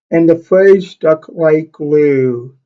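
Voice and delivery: ordinary delivery, nothing marked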